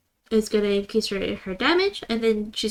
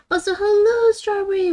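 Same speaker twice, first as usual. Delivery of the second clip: high, loud happy hello; excited greeting; 11 semitones higher, 7 dB louder than usual. Real, not acted. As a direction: greet a friend with a bright burst. Greeting a chat viewer.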